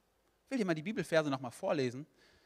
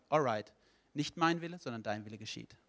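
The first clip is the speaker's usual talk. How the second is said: gentlemanly God conceding; 5 semitones lower than usual. Acted. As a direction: give in courteously, voice falling away. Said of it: "dann ist Gott Gentleman genug".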